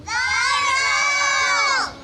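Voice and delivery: long high shout of the name; calling out. Acted. Storyteller calls the fox into the story, shouting. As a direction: call the fox from far away.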